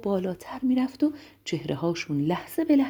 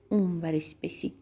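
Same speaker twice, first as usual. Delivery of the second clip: dropped, softer seesaw reply; playful low, quieter storybook voice; playful lower answer; 3 semitones lower, 5 dB quieter than usual. Acted. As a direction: drop down like the seesaw's low end. Other end of the seesaw.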